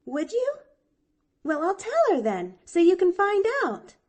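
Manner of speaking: put-on high, loud, chirpy little girl; cheerful. A narrator's reading. Pollyanna offers to tell her aunt, high and cheerful. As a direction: promise it cheerfully and eagerly. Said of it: "promised the little girl cheerfully".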